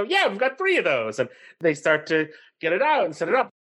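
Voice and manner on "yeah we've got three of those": much higher, upbeat reply; cheerful yes. Retold or imitated. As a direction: happily reassure her, retold. Helpers answer cheerfully.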